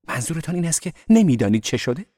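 loud, high-pitched disbelief; genuinely astonished. A narrator's reading. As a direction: ask in amazement, voice jumping up. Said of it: "به راستی شگفت زده شده بود". (با شگفتی و صدای بلند و زیر)

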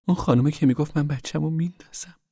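Rose of Sharon's character voice. Acted. Daughter recalls a frightening woman.

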